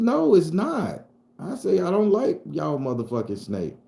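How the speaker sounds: high, loud, drawn-out protest; indignant refusal. Retold or imitated. Boy refusing the snake's offer, indignant. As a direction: object loudly, pitch way up.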